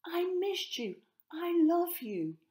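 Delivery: very high, loud happy cry; excited child shout. A narrator's reading. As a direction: shout it up to him with joy. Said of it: "Elise shouted".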